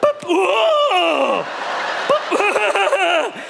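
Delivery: high delighted boop; slow shoppers amazed by the scanner, high and pleased; clueless scanner. Acted. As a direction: marvel at each beep like a child. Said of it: "stood there going".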